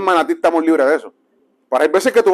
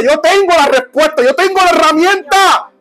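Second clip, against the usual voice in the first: high, impassioned preacher's shout; raised, forceful declaration; urgent proclamation; 8 semitones higher, 3 dB louder than usual. Acted. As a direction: cry it out to someone dying beside you. Preacher acts out urgent witness.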